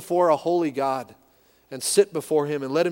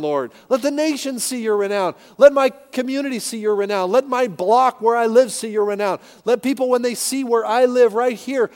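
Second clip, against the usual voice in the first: higher and louder pleading prayer; fervent prayer; 6 semitones higher, 6 dB louder than usual. Pretend. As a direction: cry out the prayer with fervor. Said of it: "we're praying".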